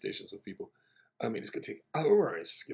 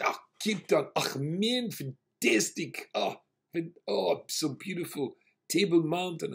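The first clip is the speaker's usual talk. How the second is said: higher and louder, drawn-out enthusiasm; gushing; 8 semitones higher, 6 dB louder, slower than usual. Retold or imitated. Rabbi flatters the passenger about Cape Town, gushing and excited. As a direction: rave about Cape Town like a homesick local.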